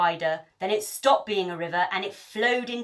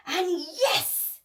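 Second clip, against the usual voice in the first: shouting; 9 semitones higher than usual. A narrator's reading. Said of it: "shouted the pirates".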